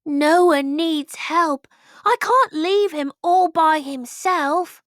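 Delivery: high, loud, slow resolve; determined thought. A narrator's reading. Leopold deciding to save his friend, determined. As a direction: summon your courage. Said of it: "He thought".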